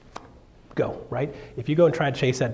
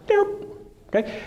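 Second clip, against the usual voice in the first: usual speaking voice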